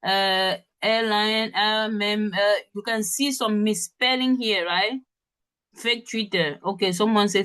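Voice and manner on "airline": normal talking voice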